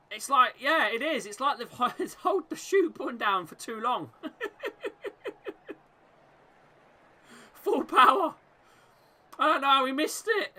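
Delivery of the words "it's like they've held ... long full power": laughing through the words; amused chuckle breaking in; real laughter at a terrible miss. Real, not acted.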